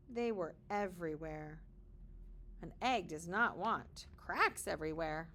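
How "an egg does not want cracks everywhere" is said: plain reading voice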